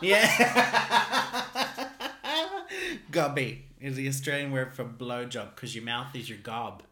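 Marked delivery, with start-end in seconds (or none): 0.3-3.1 a loud laugh shooting way up; high burst of laughter. Real, not acted. Laughing at his cohost's offer, high burst of laughter.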